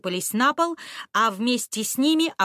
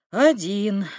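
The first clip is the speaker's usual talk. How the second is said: sad; 6 dB louder than usual. A narrator's reading. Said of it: "печально ответил".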